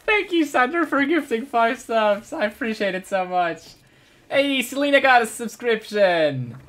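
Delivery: high, loud thank you; high cheerful thanks; excited thanks. Real, not acted. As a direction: thank each gifter with delight. Thanking subscribers brightly.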